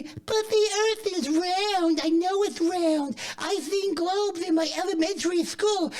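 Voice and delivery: high, slow whiny voice of a doubter; mock know-it-all listener. Comic to the audience, mocking a doubter in a high, slow whine. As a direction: mimic a smug kid quoting school globes. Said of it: "'cause you might wanna say".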